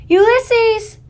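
high, loud, drawn-out holler; shouted call. Acted. Woman searching the woods for the squirrel, shouted call. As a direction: call a lost one's name through the woods. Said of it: "shouted Tootie".

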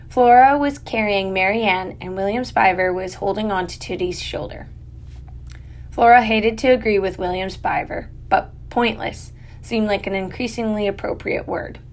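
ordinary delivery

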